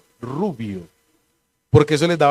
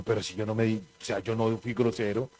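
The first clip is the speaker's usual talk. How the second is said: much softer, low and muttered in self-defence; defensive mumble; 6 semitones lower, 11 dB quieter, faster than usual. Retold or imitated. Pastor mimicking his own meek excuse to his wife, quiet and low. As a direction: play it down, barely voiced, as if wounded. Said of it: "y yo digo".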